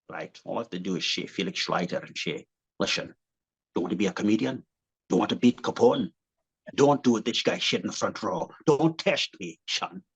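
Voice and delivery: low, slushy Scottish impression; deep Scottish movie-star voice with shushed sibilants; Sean Connery. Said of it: "did Sean Connery".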